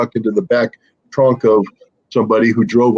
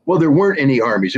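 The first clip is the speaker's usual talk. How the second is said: normal talking voice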